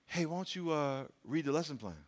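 very soft, hushed suggestion; gentle quiet voice. Retold or imitated. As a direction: whisper it like a conscience. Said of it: "God may say".

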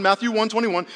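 normal talking voice

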